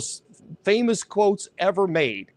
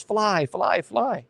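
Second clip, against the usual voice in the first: lightly voiced correct witch line; real witch quote; 3 semitones higher, much slower than usual. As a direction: give the actual words, a touch of the witch. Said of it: "She just says".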